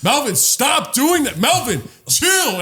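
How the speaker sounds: high, drawn-out pleading reprimand; mock scolding. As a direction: imitate exasperated family in a high voice. Imitates family scolding him.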